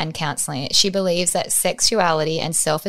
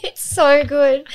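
laughing; 5 semitones higher, 5 dB louder than usual. Real, not acted. Laughing while gushing about guest.

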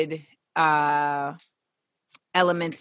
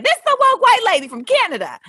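much higher and louder, a quick caricature; mocking high voice; 13 semitones higher, 7 dB louder, faster than usual. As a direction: squeal it out like a parody. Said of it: "I was like".

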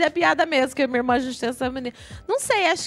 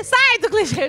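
mock shouted echo, higher and louder; mock yell; 6 semitones higher, 4 dB louder, slower than usual. Guest echoing the host's order as a mock yell. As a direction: throw the host's line back as a playful yell. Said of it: "Gritou com a convidada do nada".

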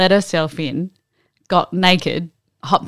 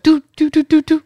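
high beeping "do" sounds; imitated Morse code; 10 semitones higher, 5 dB louder than usual. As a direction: mimic signal beeps on a high note. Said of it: "Yeah, Morse code".

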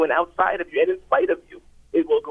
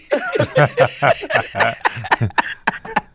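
laugh; 5 semitones lower than usual. Real, not acted. Caller laughs at own admission.

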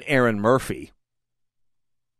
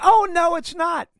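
high, loud indignant retort; indignant shout; 5 dB louder than usual. Real, not acted. Host rebutting an imagined listener, loud indignant shout. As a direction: shout it down, outraged.

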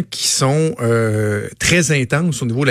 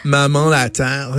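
put-on slow, drawn-out Plateau Mont-Royal accent; a lightly put-on Plateau accent, savouring each word; mock Montreal Plateau accent, slow; slower than usual. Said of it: "C'est quoi cet accent-là?".

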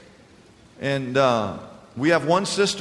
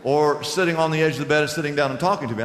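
ordinary delivery

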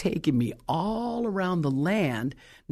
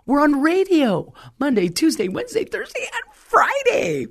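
rising excitement; 11 semitones higher, 4 dB louder than usual. Real, not acted. Preacher proclaiming to her congregation, building from high to joyous and much higher. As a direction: build the list up to a joyful peak, slowing on the last days. Preacher listing radio days.

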